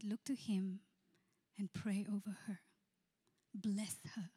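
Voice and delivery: hushed, coaxing instruction; softly urging whisper. Retold or imitated. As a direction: whisper him toward blessing her. Pastor urging groom to pray.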